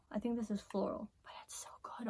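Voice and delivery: soft breathy whisper. Real, not acted. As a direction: whisper it to yourself. Whispering about product scent.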